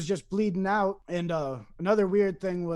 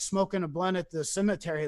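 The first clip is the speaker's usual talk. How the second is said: ordinary talk, nothing marked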